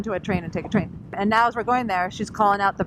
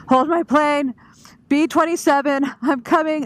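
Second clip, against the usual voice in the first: calling out; 8 semitones higher, 6 dB louder than usual. Retold or imitated. Running through airport calling out.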